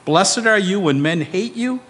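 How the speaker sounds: ordinary delivery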